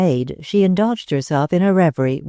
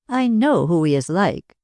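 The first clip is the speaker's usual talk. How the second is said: usual speaking voice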